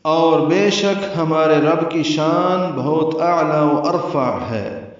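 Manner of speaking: solemn slow translation read. Shown as a demonstration. Reads the translation.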